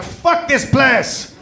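drawn-out comic outburst; mock French explorer cursing. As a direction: spit it out like a fed-up sailor. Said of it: "Dufrench was like".

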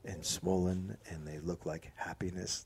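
low, hushed and soothing; soft and calm, no judgment; soft, gentle voice. Shown as a demonstration. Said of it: "you go like this".

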